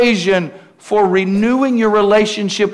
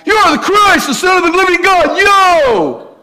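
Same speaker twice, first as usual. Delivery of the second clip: much higher, loud tough-guy shout; Rocky-style Peter; 11 semitones higher, 4 dB louder than usual. Retold or imitated. Peter declares Jesus the Christ in a tough Rocky voice. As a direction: belt it like a street fighter, ending with yo. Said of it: "He's this powerful guy".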